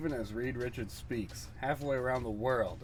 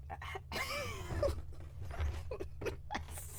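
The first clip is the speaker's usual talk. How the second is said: breathy laugh; 9 dB quieter than usual. Acted. Laugh during scene.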